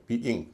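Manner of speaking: "बी इंग" as bee-ing; two syllables. Shown as a demonstration. Teacher repeating the correct form, syllables separate. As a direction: sound both vowels in "बी इंग". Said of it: "बी इंग कहेंगे".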